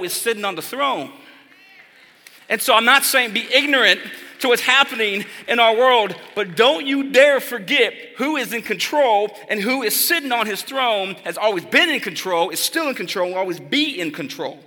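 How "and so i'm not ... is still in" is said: higher, louder declaration; don't you dare forget, say it with fire; preaching climax. Acted. Preacher warning the congregation, high and forceful.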